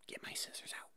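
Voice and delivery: ordinary talk, nothing marked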